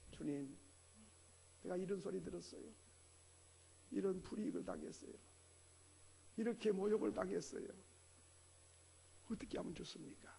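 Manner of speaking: soft, quick, pleading prayer; humble prayer. Pretend. Preacher voicing a believer praying humbly, soft and pleading. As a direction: bring the hurt quietly before God. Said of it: "하나님께 엎드려서".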